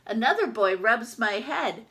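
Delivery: usual speaking voice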